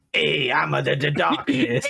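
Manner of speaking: high-pitched mock darkness villain; goofy villain voice. Host attempting the villain from the description, in a goofy voice. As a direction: botch the dark villain with a silly, halting voice. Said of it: "if I had to voice that character".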